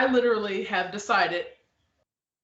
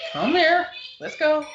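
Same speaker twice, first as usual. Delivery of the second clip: higher and louder, beckoning; mock eager invitation; 9 semitones higher, 5 dB louder than usual. Company owner mockingly welcoming everyone, loud and high. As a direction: call them in like an overeager host. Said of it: "Be like".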